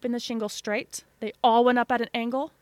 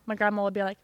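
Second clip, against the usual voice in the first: ordinary talk, nothing marked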